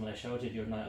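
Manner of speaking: ordinary talk, nothing marked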